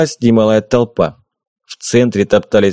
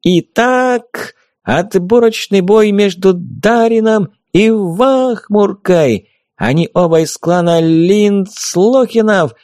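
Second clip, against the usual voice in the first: high, slow ringmaster yell; booming circus ringmaster; 9 semitones higher, slower than usual. A narrator's reading. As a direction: announce the bout like a ringmaster. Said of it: "завопила фея".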